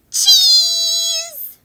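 high, loud, long-held "cheese"; photo call. Pretend. Counting down for everybody's photo, loud drawn-out call. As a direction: belt it out and stretch the vowel.

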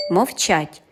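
ordinary delivery

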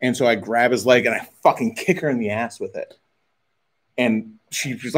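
usual speaking voice

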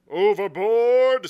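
loud, high, long-drawn cry; captain's exclamation. A narrator's reading. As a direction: boom the word out slowly. Captain exclaims at mate's vanishing.

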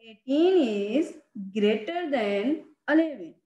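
very slow, deliberate read-out; slow reading aloud. Shown as a demonstration. Teacher modelling how to read the sign, very slow. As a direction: draw each word out for the pupils. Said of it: "और हम इसको ऐसे पढ़ेंगे".

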